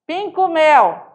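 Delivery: Pinco mel, pinga com mel merged into "pincomel"; fuse pinga com mel into two syllables; mineiro blend. Shown as a demonstration. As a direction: Minas style Pinco mel. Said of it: "Pinga com mel".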